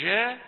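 "جاء" with a zh leaning toward sh; jeem near sheen. Shown as a demonstration. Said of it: "قريبة من شاء". (جيم "جاء" متفشية قريبة من الشين)

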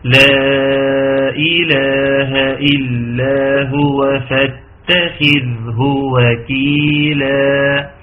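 slow, sustained chanted recitation; Quran recitation. Shown as a demonstration. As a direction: recite the verse measured, with long held vowels. Recites the verse. (ترتيل قرآني بطيء)

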